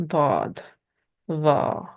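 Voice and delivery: stern, heavy consonants on "dod" and "va"; heavy sounds. Shown as a demonstration. Teacher shows the stern facial position, heavy sounds. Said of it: "The heavy sounds are".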